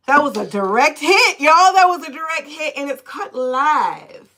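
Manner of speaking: real thrilled shout to the chat; excited. Real, not acted. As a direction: crow it to the viewers like a win. Hyping her own reading to viewers.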